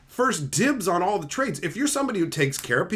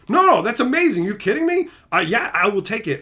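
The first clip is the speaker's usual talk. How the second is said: higher, insistent retelling of an amazed reaction; amazed acceptance; 4 semitones higher, 5 dB louder than usual. Retold or imitated. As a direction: act out an overwhelmed, grateful yes. Acting out delighted recipient of card.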